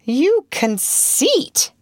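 high, very slow outraged exclamation; shocked. A narrator's reading. Wendy to boastful Peter, shocked. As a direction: draw it out in scandalized disbelief. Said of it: "Wendy was shocked".

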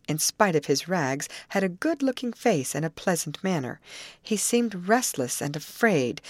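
ordinary delivery

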